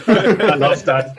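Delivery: high, loud burst of delighted laughter. Real, not acted. Swiss guest laughing at the accent comment. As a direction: laugh it out, thrilled by the compliment. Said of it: "Oh, man".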